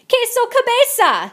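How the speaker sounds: high, loud teasing shout. A narrator's reading. Chihuahuas teasing from the rear, high loud shout. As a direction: yell it from the back. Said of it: "shouted the chimichangos".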